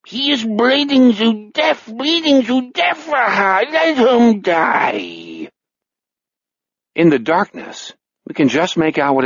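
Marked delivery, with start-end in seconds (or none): 0.1-5.5 very high, slow alien German wail; keen it in a drawn-out pseudo-German accent; shrieky mock-German second personality; extraterrestrial accent. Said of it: "speaking with the accent of an extraterrestrial".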